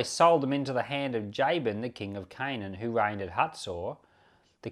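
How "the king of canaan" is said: ordinary talk, nothing marked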